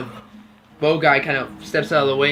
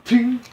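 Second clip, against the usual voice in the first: high, sharp ting; ping like a deflected arrow; ting sound effect; 8 semitones higher, 6 dB louder than usual. Acted. Second arrow blocked.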